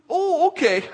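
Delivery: high, loud mock-polite acceptance; gracious dodge. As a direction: cover your doubt with an over-bright reply. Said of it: "Be gracious and say".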